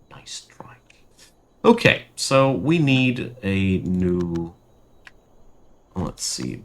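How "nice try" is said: Nice try whispered and much softer; whispered repeat. Real, not acted. Streamer mocking the game's event, whispered. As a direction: breathe Nice try as an aside.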